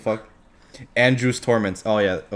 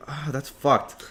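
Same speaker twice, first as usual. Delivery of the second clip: normal talking voice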